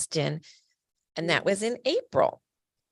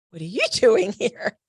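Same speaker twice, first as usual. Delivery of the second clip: high, laughing in surprise; delighted disbelief with a laugh; surprised laugh; 5 semitones higher, 4 dB louder than usual. Retold or imitated. Friend surprised by hospital visit.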